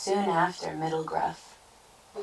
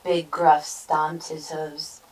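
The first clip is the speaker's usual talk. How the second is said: ordinary delivery, nothing marked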